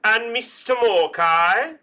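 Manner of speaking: high, loud, stretched roll call; calling up a student. Pretend. Dean calls Mister Morkai forward, loud roll call. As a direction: call the name out with a questioning lift.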